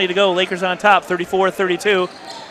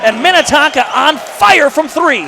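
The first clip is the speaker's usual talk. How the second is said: higher, slow, drawn-out call; excited play call; 6 semitones higher than usual. Real, not acted. Commentator celebrating Minnetonka's three, excited. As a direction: stretch out the big three-point call.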